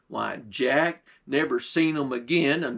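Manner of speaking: plain reading voice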